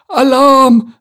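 very loud high alarm call; shout. Acted. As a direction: raise the alarm at the top of her voice. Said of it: "rief sie".

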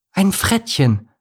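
high, horrified character voice; horrified gasp. A narrator's reading. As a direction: gasp it out in alarm. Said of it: "keuchte Miri entsetzt".

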